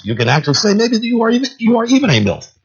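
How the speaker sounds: high, quick teasing voice; a lady's mock-sweet jab; mocking woman. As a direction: tease them in a high, fast voice. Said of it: "She says".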